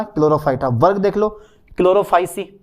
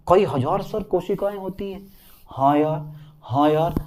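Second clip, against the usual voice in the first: soft, understated pupil voice; student's quiet echo; 5 dB quieter than usual. Acted. Student checking the cell count with sir, softly. Acts out quiet exchange repeating the fact.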